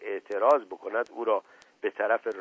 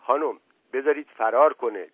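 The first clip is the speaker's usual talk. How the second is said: mildly anxious, louder plea; worried; 3 semitones higher, 7 dB louder than usual. A narrator's reading. Boy begging the lady to let uncle flee, mildly worried. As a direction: plead with a touch of worry. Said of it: "با نگرانی گفتم".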